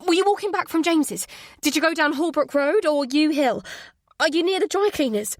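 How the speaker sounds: high, loud, rushed questions; frantic. Acted. Mother questioning her hurt son on the phone, frantic. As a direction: fire questions in panic. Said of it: "my voice frantic".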